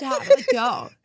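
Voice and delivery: put-on high, loud American kid's voice; American accent. Shown as a demonstration. Guest demonstrating her overdubbed film line, American accent. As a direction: yell it like an American kid, laughing. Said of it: "So you did an American accent?".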